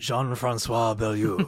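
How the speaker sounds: slow hammed-up French accent; lightly hammed French pronunciation of the name. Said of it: "the Fantastic Four accent".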